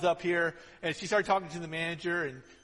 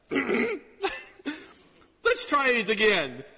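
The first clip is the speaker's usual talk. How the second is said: stern do-over; 8 semitones higher, 7 dB louder, slower than usual. Retold or imitated. Father makes the daughter retry the confession.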